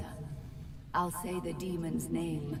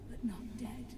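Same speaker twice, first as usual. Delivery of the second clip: whisper; 3 semitones higher, 6 dB quieter than usual. Acted. Spirit whispering in game.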